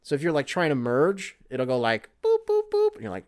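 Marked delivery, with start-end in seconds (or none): 2.2-2.9 high mouth beeps like a car sensor; beeping alarm imitation. High beeps mimicking the blind spot sensor for the audience. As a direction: squeak out the warning beeps, high.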